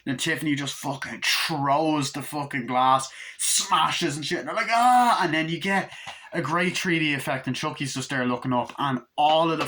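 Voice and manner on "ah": high, loud mock scream; imitated scream. Couple as the glass smashes, loud mock scream.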